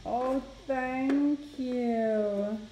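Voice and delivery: high, drawn-out cooing. Real, not acted. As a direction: coo to a little one. Mother plays with toddler.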